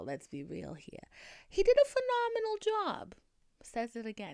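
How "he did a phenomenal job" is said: very high sing-out, falling at the end; high-pitched mock praise. Speaker jokingly overpraises Harold's acting, very high-pitched. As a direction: squeal it out in exaggerated praise.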